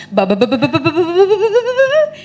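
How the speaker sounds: machine-gun syllables rising in pitch; non-stop jabber. Retold or imitated. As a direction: imitate someone rattling on without a breath. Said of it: "he just goes non-stop".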